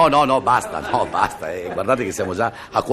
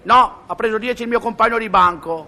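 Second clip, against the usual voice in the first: put-on child's voice; 10 semitones higher than usual. Child answers mother.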